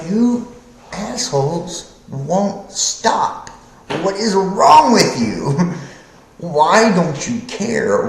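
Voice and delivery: slow, baffled rage at the people; exasperated Jonah. Retold or imitated. Jonah venting at the people, high and slow. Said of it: "He's like".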